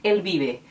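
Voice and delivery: El Vive as el BI-be, clear l; Spanish El Vive. Shown as a demonstration. Teacher demonstrating Spanish El Vive, v as b. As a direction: say El Vive with b sounds, slowly. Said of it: "se pronunciam com som de B".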